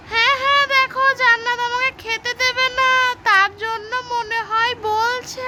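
squeaky tattling doll; high doll voice. Pretend. Toy friend tattles. (উঁচু পুতুলের গলায় নালিশ)

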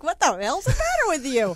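imagined loud retort. Retold or imitated. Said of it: "I'd be like".

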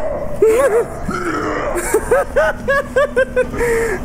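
high shrieking panicked laugh; scared screaming laugh. Real, not acted.

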